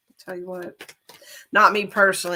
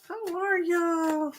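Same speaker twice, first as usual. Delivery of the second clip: slow, high Texan drawl on y'all; hammed-up Southern Texan greeting; Texan cowgirl drawl; 9 semitones higher, much slower than usual. Shown as a demonstration. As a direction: stretch it out like a Texas cowgirl. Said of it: "I can sound like a cowgirl".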